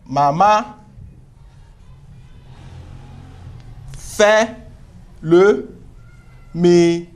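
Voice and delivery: each word spaced out while writing; slow dictation. Shown as a demonstration. Teacher writing on the board, dictating slowly. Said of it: "je vais écrire ça en cursive".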